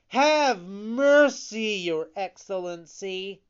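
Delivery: high, loud begging; pleading. A narrator's reading. Pinocchio trying another title on the showman, high pleading. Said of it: "Then he pleaded".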